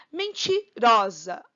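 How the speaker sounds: Mentirosa said slowly, leaning on ró; stressed syllable shown. Shown as a demonstration. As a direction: stretch Mentirosa and hit ró. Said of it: "sílaba tônica no ró".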